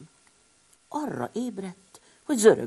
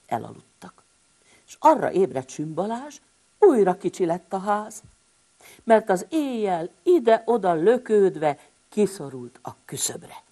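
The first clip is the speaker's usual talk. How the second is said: plain reading voice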